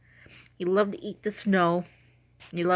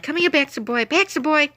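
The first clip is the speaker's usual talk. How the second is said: higher-pitched coaxing dog call; 7 semitones higher, 4 dB louder than usual. Retold or imitated. Owner calling her sleepy dog to come, high coaxing call. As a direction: call a pet by name, rising and louder.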